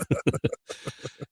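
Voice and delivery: airy silent laugh; breathy laugh. Real, not acted. Laughing at her own joke in an airy laugh.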